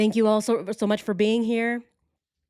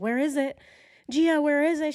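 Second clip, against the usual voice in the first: higher anxious mock plea; frantic pleading; 8 semitones higher than usual. Owner begging her cat to find the phone. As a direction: plead with the cat in a rising, worried voice. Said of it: "I'm like".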